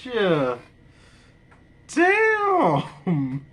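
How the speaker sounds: high-pitched, still cracking up; laughing. Real, not acted. Fan asking himself about the Jetman gear, still laughing. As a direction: squeeze the words out high, on the edge of laughter. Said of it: "I'm laughing so much".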